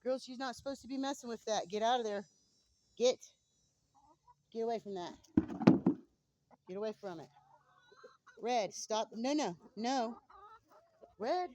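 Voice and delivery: high, loud scolding; scolding the chickens. Real, not acted. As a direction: shoo them off, loud and high.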